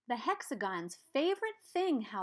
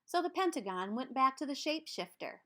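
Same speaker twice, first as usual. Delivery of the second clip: ordinary delivery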